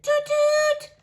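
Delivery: high, loud toot toot; trumpet toot. A narrator's reading. Bean playing his trumpet, high and loud.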